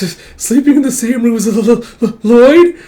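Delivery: high, loud, stammering panic; flustered. Acted. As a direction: stumble over the name, rising to a squeal. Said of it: "getting blushing and all flustered".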